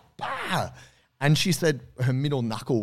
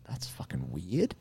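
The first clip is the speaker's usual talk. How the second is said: much softer, slow deadpan; deadpan mutter; 5 semitones lower, 9 dB quieter, slower than usual. Retold or imitated. Arborist seeing cones fly, soft slow deadpan. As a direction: mutter it under your breath. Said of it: "And she's like".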